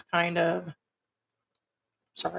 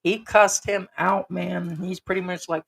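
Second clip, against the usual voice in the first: ordinary talk, nothing marked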